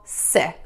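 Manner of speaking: "si" short and on its own; short syllable. Shown as a demonstration. Teacher starts learners on the first syllable, short "si". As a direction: clip "si" to a brief syllable. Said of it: "saying this little short syllable".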